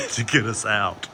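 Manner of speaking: ordinary delivery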